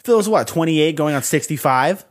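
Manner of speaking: loud, high-pitched teasing jab; mock scorn. Host ribbing a young listener about age, mock scornful. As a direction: rib him loudly in pretend disbelief.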